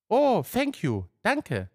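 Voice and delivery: high, drawn-out joyful gratitude; delighted thanks. Acted. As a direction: let a relieved, happy thank-you sail up high. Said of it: "sagte sie mit leuchtenden Augen". (freudig und dankbar, mit hoher Stimme)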